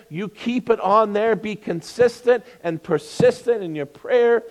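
loud, fervent preacher; preaching. Acted. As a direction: preach it from the pulpit. Preaching at full force.

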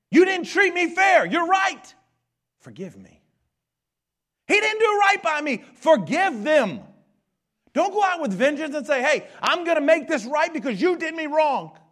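loud, high preaching, driving every line; full-force preaching. Acted. Pastor drives the fairness point home, preaching at full volume. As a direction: preach it hard to the congregation.

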